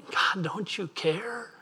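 high, slow pleading cry; high, slow anguished plea; desperate plea to God. Retold or imitated. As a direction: cry out to God, lifted and drawn out. Preacher voices a desperate plea.

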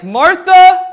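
Martha called out high and loud; emphatic call. Shown as a demonstration. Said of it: "said Martha in such a way with so much emphasis".

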